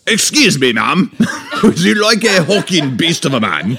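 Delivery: high, loud, over-the-top suitor; hammy he-man. Acted. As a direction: overblown theatrical strongman voice, laughing midway. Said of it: "I'm scared of the man".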